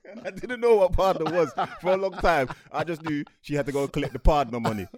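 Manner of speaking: loud, amused confession through laughter; laughing while talking. Real, not acted. Host admitting childhood ignorance to co-host, laughing. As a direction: talk through a burst of real laughter.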